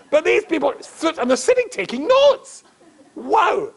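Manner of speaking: high, astonished exclamation; incredulous. Acted. Rabbi marvels at students taking notes, incredulous.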